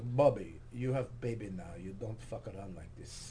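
put-on low, soft Russian-accented scolding; Russian woman. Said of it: "She's a Russian".

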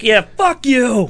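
slow, high, loud heckler jeer; mock heckler. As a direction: drag it out like a hostile drunk. Crowd retort in put-on voice.

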